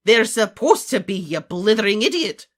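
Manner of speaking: high, very loud scolding; furious professor's reprimand; angry shout. A narrator's reading. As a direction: shout in utter exasperation. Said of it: "shouted McGonagall".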